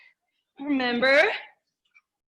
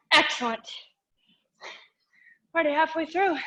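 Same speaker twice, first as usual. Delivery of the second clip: ordinary talk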